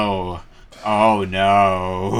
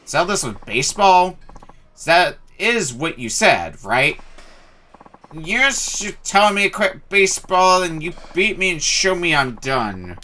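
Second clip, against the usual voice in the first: put-on higher drunk challenge; 7 semitones higher than usual. Drunk NPC misunderstands, challenges.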